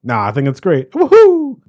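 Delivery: mock cartoon plumber voice, ending in a high, loud whoop; Charles Martinet voice. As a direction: imitate a cheery cartoon character, then whoop. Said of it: "Charles Martinet playing a different character".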